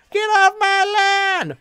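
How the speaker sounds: put-on high, slow, loud old geezer shout; cranky old man yell. Old man yelling at people on his lawn, high slow shout. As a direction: bellow it like an angry grandpa.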